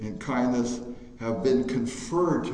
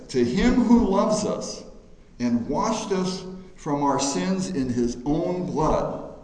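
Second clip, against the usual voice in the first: slow, loud, solemn reading from the pulpit; scripture reading; 2 semitones higher, 4 dB louder, slower than usual. Acted. Preacher reading Revelation to the congregation, slow and loud. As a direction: proclaim each phrase, slowly. Reads scripture aloud in church.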